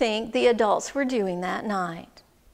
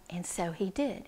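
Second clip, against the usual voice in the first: plain reading voice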